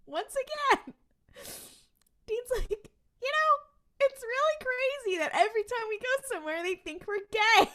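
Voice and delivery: high-pitched, laughing through the words; squeaky, amused quote breaking into laughter; laughing retelling. Real, not acted. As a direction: barely get the line out for real laughter. Laughs while retelling Dean's line.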